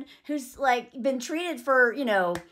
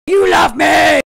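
loud, higher acted yell; mock shout; 3 semitones higher, 13 dB louder than usual. Husband yelling at his wife in a fight, mock shout. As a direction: belt it like the desperate husband.

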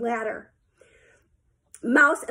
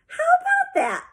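very high, gleeful exclamation; delighted. A narrator's reading. Coach marvelling at Sheila's success, delighted. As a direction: crow with delighted wonder.

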